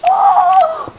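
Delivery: very high, loud gasp of a word; shocked cry. A narrator's reading. Narrator as everyone falls, loud shocked cry. Exclamation as they fall.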